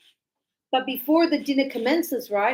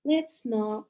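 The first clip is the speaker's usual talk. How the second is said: slow, softer, tired decline; reluctant refusal; 5 dB quieter, slower than usual. Shown as a demonstration. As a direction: drag it out, unwilling. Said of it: "somebody else could say".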